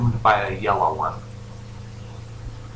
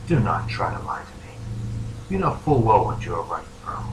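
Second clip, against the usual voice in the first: low, whispery phantom voice; hoarse menacing figure; 6 semitones lower, 5 dB quieter than usual. Acted. Phantom warning the dreamer not to lie, hushed and menacing. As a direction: drop into a hushed, husky threat. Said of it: "a hoarse chuckle pierces the air".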